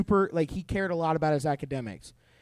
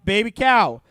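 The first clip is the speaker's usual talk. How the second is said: usual speaking voice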